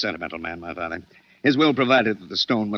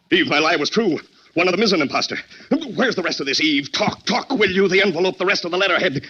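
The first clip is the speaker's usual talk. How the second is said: frantic agitation; 10 semitones higher than usual. Acted. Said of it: "Mark, you'll have another attack".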